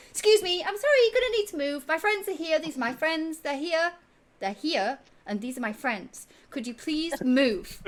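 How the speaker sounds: very high and loud, fast polite shooing; cheerfully bully the patrons off their table; pushy tabaxi host. Acted. Tabaxi clearing strangers from a table for his friends, high and loud. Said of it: "He's like".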